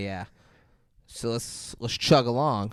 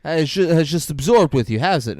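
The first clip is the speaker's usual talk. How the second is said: higher, louder exasperated retelling; annoyed; 4 semitones higher, 5 dB louder than usual. Retold or imitated. Annoyed shooter scolding a clueless questioner, exasperated. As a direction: imitate someone who has run out of patience. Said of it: "this guy's just annoyed with Pablo Escobar".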